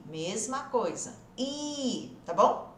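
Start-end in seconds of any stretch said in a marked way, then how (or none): 1.4-2.2 I drawn out as acute í; stretched í. Shown as a demonstration. Teacher repeats the E A sound, stretched í. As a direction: hold "I" long with spread lips. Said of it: "bem estendido".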